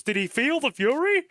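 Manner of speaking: high mock battle cry, swooping up; imitating game voice line. Streamer quoting the marvelous voice direction, high rising imitation. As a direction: parrot the line, pitch climbing to the end. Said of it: "just the way he said it".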